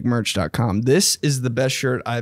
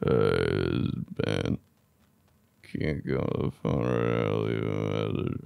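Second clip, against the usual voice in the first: groggy voicemail; 10 dB quieter than usual. Retold or imitated. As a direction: sound drunk and half sleeping on the message. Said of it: "Played it back. It was like".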